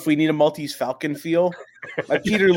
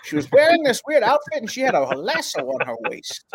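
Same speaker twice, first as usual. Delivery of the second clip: hardboiled detective voiceover; noir detective; 6 semitones higher than usual. Pretend. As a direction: narrate it like a gumshoe. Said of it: "record yourself voicing right there".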